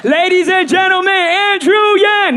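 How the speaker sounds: high, drawn-out hype announcer; mock announcer. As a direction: introduce him like a star, stretching the name. Mock introduction of a candidate.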